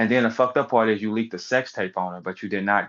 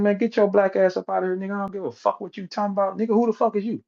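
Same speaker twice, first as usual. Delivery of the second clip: mock doctor; 8 semitones higher than usual. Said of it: "the doctor said".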